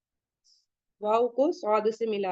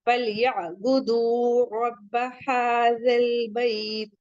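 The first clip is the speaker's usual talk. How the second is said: slow chanted verse recitation; slow tajweed recitation of the verse; Quran recitation; much slower than usual. Shown as a demonstration. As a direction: recite the verse slowly and melodically. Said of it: "तो हम क्या पढ़ेंगे".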